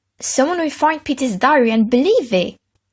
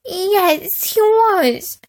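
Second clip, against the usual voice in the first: high, tearful sniffle; sniffling; 11 semitones higher, much slower than usual. A narrator's reading. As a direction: whimper it through sniffs. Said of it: "sniffled Peter".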